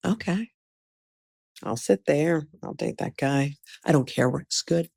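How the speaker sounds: softly talking herself into it; quiet, resigned inner talk; half-hearted settling. Retold or imitated. Lowered hopes at second station.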